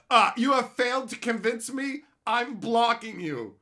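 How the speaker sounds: loud, high imitation of an offended troll; hammed-up indignant dismissal; mock pompous verdict. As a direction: pass judgment as a huffy stranger. Imitating the offended Twitter user.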